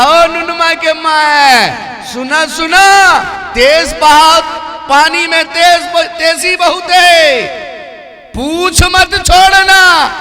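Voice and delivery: loud, slow, high shouted warning over the water; calling out across the river. Acted. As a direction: yell slowly to the wife in the current. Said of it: "کہا،". (ندی میں بیوی کو زور سے پکار کر تنبیہ)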